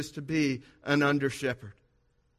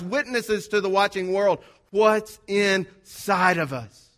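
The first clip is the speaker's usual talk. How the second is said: louder, emphatic pulpit voice; preaching; 3 semitones higher, 5 dB louder than usual. Acted. Pastor applying the bottle lesson to the church, preaching. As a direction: press the lesson home.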